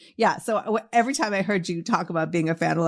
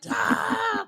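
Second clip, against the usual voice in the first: high thin squeal at usual volume; mock inner scream. Fan inwardly losing her mind meeting her idol, mock squeal. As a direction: squeal like an overexcited fan kept inside. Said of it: "inside, I was like".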